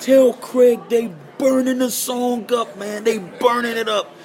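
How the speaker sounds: high, loud and slow, hyped up; excited report. Retold or imitated. Mentor hyped that radio is playing the song, loud and excited. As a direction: brag it loud like the song's a hit.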